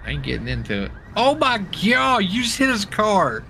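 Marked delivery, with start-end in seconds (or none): 1.2-3.4 high-pitched, drawn-out gasp of disbelief; shocked outcry. Real, not acted. Friend sees a car get hit, shocked outcry. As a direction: cry it out high, astonished.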